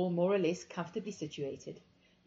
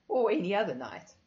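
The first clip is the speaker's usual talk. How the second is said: plain reading voice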